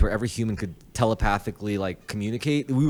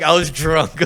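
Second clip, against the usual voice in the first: mock excuse; 6 semitones higher, 7 dB louder, slower than usual.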